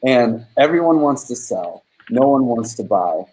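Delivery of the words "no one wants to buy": ordinary talk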